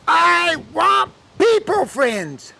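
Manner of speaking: put-on high, stretched-out childish wail; wailing little girl. Lonely girl refusing the cow, high drawn-out wail. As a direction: cry it out slowly at a high pitch.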